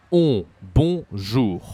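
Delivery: loud "on", then "Bonjour" with the same nasal vowel; nasal sound. Shown as a demonstration. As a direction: model "on. Bonjour." emphatically, no n. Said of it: "in French a nasal sound".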